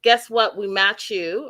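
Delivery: ordinary delivery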